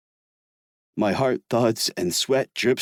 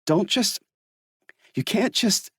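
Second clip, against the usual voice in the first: Everett's voice pitched higher, urgent and pleading; 11 semitones higher than usual. Acted. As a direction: cut yourself off, begging him not to leave.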